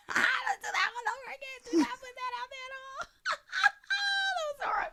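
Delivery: high-pitched laughing through words. Real, not acted. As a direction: crack up while talking. Laughing at own blooper.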